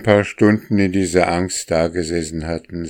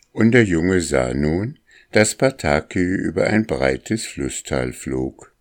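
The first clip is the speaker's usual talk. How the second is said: plain reading voice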